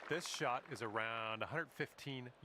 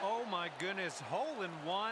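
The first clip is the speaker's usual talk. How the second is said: higher, louder thrilled outburst; excited exclaim; 6 semitones higher, 3 dB louder than usual. Real, not acted. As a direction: blurt it out in disbelief. Hole in one reaction.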